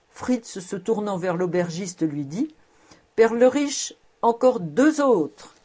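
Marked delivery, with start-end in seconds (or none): 3.1-5.5 louder and higher, slow call across the inn. A narrator's reading. Fritz calling the innkeeper for more wine, loud and slow. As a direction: hail the innkeeper for two more bottles.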